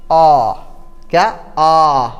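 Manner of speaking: "आ" rounded to aw, between aa and o; in-between vowel. Shown as a demonstration. Teacher demonstrating the borrowed vowel, "आ" sounded as aw. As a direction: hold "आ" as an open aw. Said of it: "आ और ओ के बीच का स्वर".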